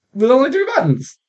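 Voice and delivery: incredulous, rising high at the end; exasperated question. Real, not acted. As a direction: complain in disbelief, voice shooting up.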